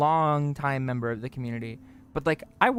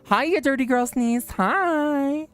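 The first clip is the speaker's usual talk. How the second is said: put-on teasing baby talk; 10 semitones higher, 4 dB louder, much slower than usual. Greeting niece in cute voice.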